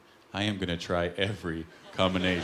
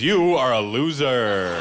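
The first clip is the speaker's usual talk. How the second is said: drawn-out, loud hotline recording; automated message; 7 dB louder, much slower than usual. Acted. Rejection hotline telling the caller off, loud automated-message voice. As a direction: announce it slowly and loudly like a recording. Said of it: "an automated message comes on and goes".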